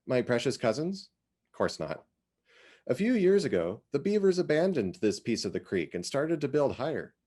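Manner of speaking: put-on chatty older woman sharing local gossip; lightly voiced Iz. Iz tells Cynthia about Lydia, lightly voiced chatty neighbour. Iz recounting the flood.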